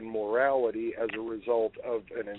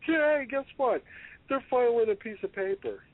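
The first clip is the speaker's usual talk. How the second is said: high-pitched jeering mock amusement; mocking mob-boss laughter; 10 semitones higher than usual. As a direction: play a crime boss cracking up, voice leaping high. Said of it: "sitting up there laughing".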